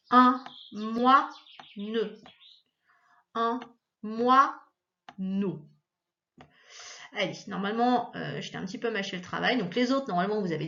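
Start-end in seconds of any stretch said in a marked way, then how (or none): 0.1-2.2 "moineux" very slowly as moi, then ne; drawn-out "moineux", gap before the final ne; split syllables. Shown as a demonstration. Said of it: "c'est coupé". 3.3-5.7 "moineau" broken into moi, then neau; "moineau" with a clear gap before neau; split syllables. Shown as a demonstration. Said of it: "coupé ici".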